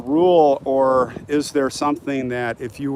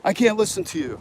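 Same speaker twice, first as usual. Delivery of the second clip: higher mocking voice of a nagging viewer; mocking critic; 6 semitones higher than usual. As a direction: parrot her complaint back in a higher whiny voice. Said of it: "she just ripped me apart".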